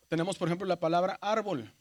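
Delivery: normal talking voice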